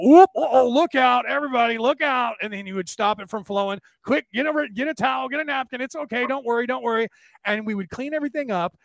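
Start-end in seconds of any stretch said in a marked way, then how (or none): 0.0-2.3 high, loud mock warning; imitated lively alarm over a small mishap; playful alarm. Imitating father's reaction to spill. 4.0-7.1 reassuring dad. Retold or imitated. Father urging calm cleanup, retold.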